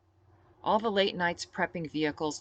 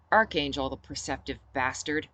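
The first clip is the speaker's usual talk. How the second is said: ordinary delivery, nothing marked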